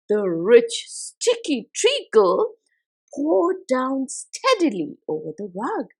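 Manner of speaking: plain reading voice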